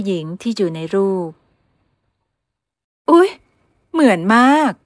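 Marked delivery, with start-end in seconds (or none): 2.2-4.7 much higher, louder gasp of surprise; mother amazed the girl matches the photo; surprised exclaim. Acted. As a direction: gasp at how alike they look.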